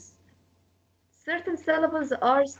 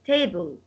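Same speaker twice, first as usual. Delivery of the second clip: "table" with a long, stressed first vowel; stress on first syllable; 7 semitones lower than usual. Shown as a demonstration. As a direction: lean on the TA of "table". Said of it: "the stress will be on ta".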